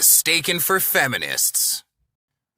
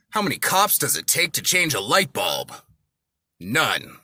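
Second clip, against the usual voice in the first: normal talking voice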